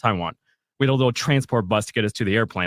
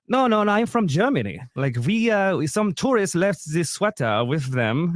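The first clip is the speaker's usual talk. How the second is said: imitating a German woman explaining; hammed-up German tourist voice; super German accent; 7 semitones higher than usual. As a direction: put on a German accent, starting high. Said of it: "like, super German accent".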